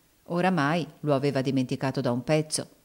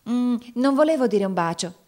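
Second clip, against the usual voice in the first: hasty correction; 6 semitones higher, 4 dB louder than usual. A narrator's reading. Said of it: "essa s'affrettò a correggere".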